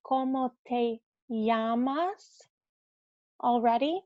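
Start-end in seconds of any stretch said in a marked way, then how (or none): 0.1-2.5 Cómo te llamas slowly, LL as Y, rising end; slow Spanish question. Shown as a demonstration. Teacher reminding the class of a learned phrase. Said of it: "Like we've learned".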